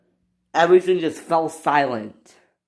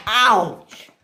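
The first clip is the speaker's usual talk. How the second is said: high acted ouch; pained high exclamation; cry of pain; 6 semitones higher, 3 dB louder than usual. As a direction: yelp it on impact. Engine crashes.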